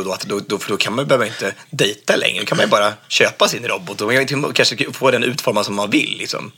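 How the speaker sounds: ordinary delivery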